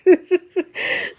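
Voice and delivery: high giggle at oneself; amused laugh. Real, not acted. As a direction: giggle after your own silly outburst. Laughs at own mock panic.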